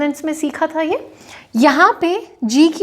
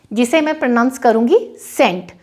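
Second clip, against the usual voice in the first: usual speaking voice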